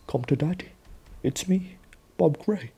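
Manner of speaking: high, soft sing-out to a daughter; creepy daddy voice. Retold or imitated. Villain posing as her long-lost dad, high creepy coaxing. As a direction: put on a high, hushed, luring father voice. Acting the returned father luring her.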